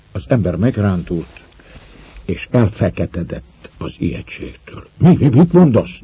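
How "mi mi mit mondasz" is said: high, startled stammering question; frightened stammer. A narrator's reading. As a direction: stumble over the words in sudden terror. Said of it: "dadogta riadtan". (riadt dadogás, magasan)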